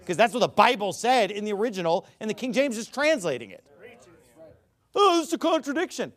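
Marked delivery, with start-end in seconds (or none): 4.9-6.1 high-pitched mock complaint; mocking objection. Preacher imitating a critic of the Bible, mocking, high. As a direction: parrot a critic's whine in a higher voice.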